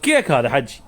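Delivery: said through a laugh; chuckling while selling. Real, not acted. Seller jokes with customer.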